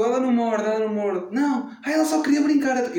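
high and reassuring; reassuring mother. Retold or imitated. Imita a mãe a acalmar. (mãe a tranquilizar, voz aguda)

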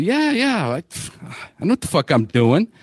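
quick high dismissive "yeah, yeah", then a touch of irritation; defensive brush-off. Retold or imitated. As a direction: brush off the worried friend, mildly annoyed. Defensive reply to worried friend.

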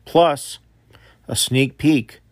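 normal talking voice

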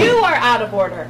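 loud, sharp call to order; loud rebuke. Real, not acted. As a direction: shout him down to order. Chair shouts at speaker.

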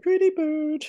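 very high, squawky parrot. Pretend. Parrot voice, Sixth Sense.

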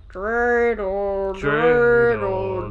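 low, creepy singsong voice; a slightly off kids' TV creep, drawn out and low; creepy children's show. Pretend. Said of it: "slow, creepy children's drama".

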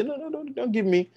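acted proud person frantically declining help; defensive refusal. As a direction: wave it away in a rising fluster. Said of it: "So they say".

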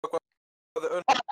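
glitchy robot voice from lag; choppy metallic call audio; robotic voice. Real, not acted. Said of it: "You turned into a robot".

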